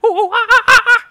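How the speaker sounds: very high, very loud monkey hooting; ape hoots. Pretend. A man who can only make animal noises warns a lumberjack with loud ape hoots. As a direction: hoot like a panicking ape.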